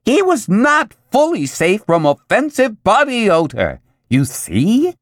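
shrill it out like a nagging commercial. A narrator's reading. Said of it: "the commercial shrilled".

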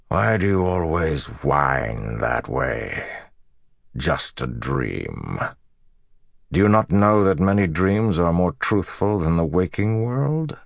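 slow, drawn-out contempt rising into a high challenge; scornful villain Moradin. Acted. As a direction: sneer at a whining enemy, taking your time.

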